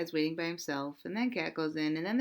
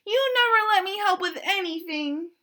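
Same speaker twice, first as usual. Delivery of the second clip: high, loud hurt complaint; wailing duck; 11 semitones higher, 8 dB louder than usual. Acted. Duck cries at the cat and squirrel, loud and high. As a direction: cry out the unfairness.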